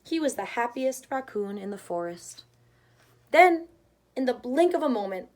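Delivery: usual speaking voice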